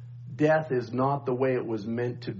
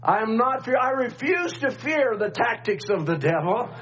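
preaching; 6 semitones higher than usual. Acted. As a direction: preach it with rising fire. Rising preaching at full force.

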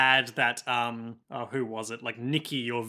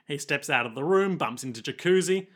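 ordinary talk

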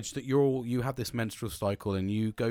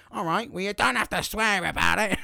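raised, louder, put-out daughter voice; daughter's indignant retort; 7 semitones higher, 4 dB louder than usual. Retold or imitated. Imitating his daughter snapping back at his swearing, higher and louder. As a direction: mimic her taking offence at the swearing. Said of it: "She was like".

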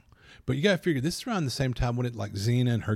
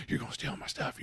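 hushed, wary whisper behind your back; nervous townsfolk murmur; suspicious whisper; 3 semitones higher, 9 dB quieter than usual. Retold or imitated. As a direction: whisper it like you don't trust him.

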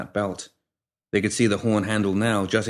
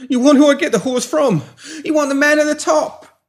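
frantic; 7 dB louder than usual. A narrator's reading. Terrified pimp giving up boss.

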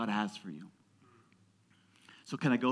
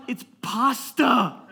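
high, loud, drawn-out protest; mock exasperated yell; 9 semitones higher, 8 dB louder, much slower than usual. Dad answering his picky daughter, mock exasperated yell. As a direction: draw it out in acted frustration.

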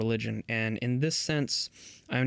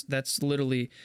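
normal talking voice

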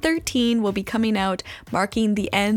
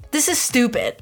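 ordinary talk, nothing marked

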